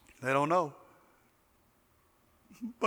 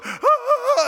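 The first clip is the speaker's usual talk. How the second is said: mock alarm; 4 dB louder, slower than usual. Reacting to coach cursing.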